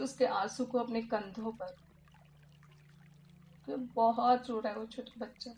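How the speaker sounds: hushed therapist leading a healing exercise; guided meditation. Acted. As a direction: guide the listener gently through the visualization.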